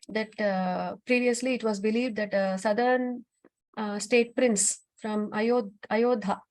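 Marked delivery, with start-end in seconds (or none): none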